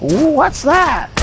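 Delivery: put-on very high, slow old-lady voice; hammed-up confused elderly woman, falsetto. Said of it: "to an eighty-five-year-old woman".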